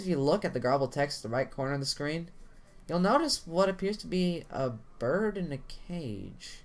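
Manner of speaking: usual speaking voice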